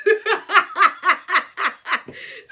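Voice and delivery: high, very loud cackle; long burst of laughter; real belly laugh. Real, not acted. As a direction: crack up helplessly at your own story. Cracks up at her own story.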